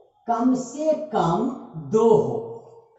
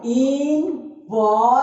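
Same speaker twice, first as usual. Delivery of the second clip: Important in three slow beats, इं-पॉर-टेंट; syllable by syllable; slower than usual. Shown as a demonstration. Teacher counts the breaks, important slowly split up. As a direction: draw out each syllable of important. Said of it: "important के तोड़े तो कितना बार में?".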